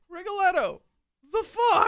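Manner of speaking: high, loud disbelieving yelp; mock baffled outburst. At the end of Rigoletto, a high mock yelp. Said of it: "by the end, you're like".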